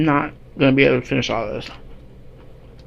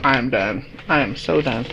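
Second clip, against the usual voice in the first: low, tired, overstuffed voice; stuffed full; 4 semitones lower, 5 dB quieter than usual. Real, not acted. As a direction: groan it out after a huge meal. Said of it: "I can't eat another bite".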